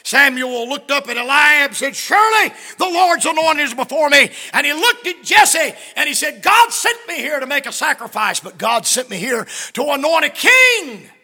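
loud, high preacher's cry; preaching at full force. Acted. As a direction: preach it out to the congregation. Preaching the anointing story.

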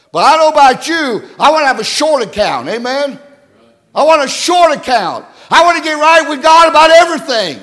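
loud, high-pitched pulpit appeal; impassioned preaching. Real, not acted. Preacher urging the congregation to keep a short account, impassioned preaching.

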